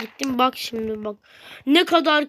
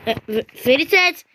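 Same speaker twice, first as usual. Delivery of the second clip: ordinary delivery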